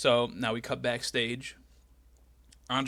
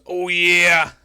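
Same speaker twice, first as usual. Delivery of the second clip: loud growled "Oh, yeah" opening, then a gruff wrestler promo; Macho Man impression; 5 dB louder than usual. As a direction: channel Macho Man's intensity. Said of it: "So he just goes".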